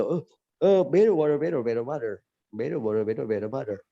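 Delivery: blur it together in an American accent; gargled-sounding American flapped T's. Shown as a demonstration. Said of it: "Saya ulangi ya".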